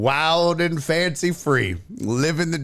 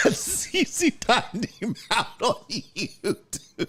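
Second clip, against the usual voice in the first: real cracking-up; breaking into laughter; 4 semitones higher, 4 dB quieter, slower than usual. Real, not acted. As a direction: start incredulous, dissolve into laughing. Cracking up mid-story.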